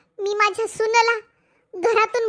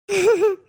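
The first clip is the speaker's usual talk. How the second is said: mocking laugh. Villainous daughter-in-law mocks mother-in-law.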